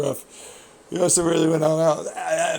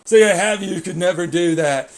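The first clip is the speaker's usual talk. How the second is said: loud, high mocking jab; retold taunt; 4 semitones higher, 9 dB louder than usual. Racer daring him he can't do it, loud and high. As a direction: throw his dare back loudly. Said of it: "that's what he said".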